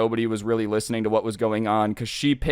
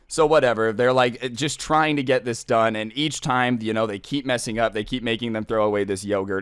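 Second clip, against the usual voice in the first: ordinary delivery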